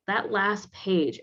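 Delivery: ordinary talk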